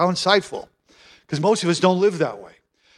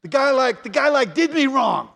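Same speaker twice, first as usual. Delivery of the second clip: higher, hammed-up trash talk at a funeral; mock bitter eulogy; 5 semitones higher than usual. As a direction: play a petty preacher badmouthing the dead, mock. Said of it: "never got up there and said".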